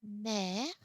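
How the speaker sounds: Mère with mouth opened wider on "è"; Mère loud and open, rising; open accent grave. Shown as a demonstration. French teacher correcting the mouth shape, Mère with open è. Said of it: "open the mouth a little bit more".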